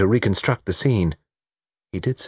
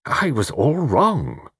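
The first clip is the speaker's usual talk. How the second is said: slow, higher-pitched humble admission; humble murmur; 7 semitones higher, much slower than usual. A narrator's reading. Mister Satterthwaite admits his mistake to Quin, slow and humble. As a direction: own up humbly, drawing the words out. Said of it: "he murmured humbly".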